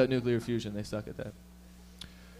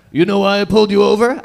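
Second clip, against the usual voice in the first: barking traffic cop, much louder; 5 semitones higher, 11 dB louder than usual. Retold or imitated. Cop questioning the driver, loud and stern. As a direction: demand it like a cop at the window.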